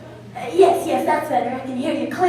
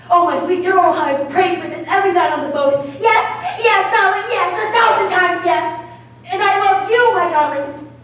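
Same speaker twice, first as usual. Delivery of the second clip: high, loud swooning yeses; gushing rapture; 5 semitones higher, 4 dB louder than usual. Acted. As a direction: pour out adoration to a lover. Accepting proposal on the phone.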